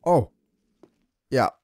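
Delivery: usual speaking voice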